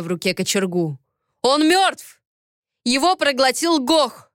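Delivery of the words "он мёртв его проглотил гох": high, forceful narrated shout; heated, insistent outburst; raised voice. A narrator's reading. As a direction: raise the voice to overrule her. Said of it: "повысил он голос".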